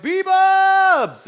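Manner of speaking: high, drawn-out yell of the name; excited chat greeting. Retold or imitated. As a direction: imitate fans hollering a greeting. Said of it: "Some people are like".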